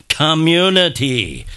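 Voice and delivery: COMMUNITY as one word, drawn out slowly; slow, emphatic reveal of "Community"; blended into one word. Shown as a demonstration. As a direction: stretch "Community" out as a single word. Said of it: "Say the abbreviation".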